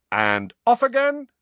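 high showman's call; shouted. A narrator's reading. George presents his hat trick, shouted. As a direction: cry it out to an audience. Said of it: "shouted George".

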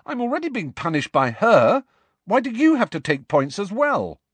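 loud, high indignant complaint; furious protest. A narrator's reading. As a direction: argue back, stung by injustice. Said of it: "furious at this injustice".

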